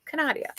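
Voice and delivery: "Canadia" lifted high as ka-NAH-dee-uh; high, mock-refined "nah" in "Canadia"; mock fancy. Shown as a demonstration. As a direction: say "Canadia" grandly with "ah". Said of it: "makes them sound more fancy".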